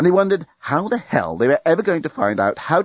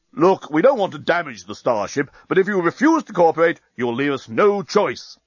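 formal warning, slowing on the threat; mildly booming, stern official voice; 5 semitones higher than usual. A narrator's reading. Enemy fleet warning the starship to cooperate, booming. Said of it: "Then the voice was booming out again".

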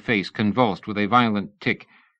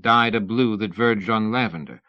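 usual speaking voice

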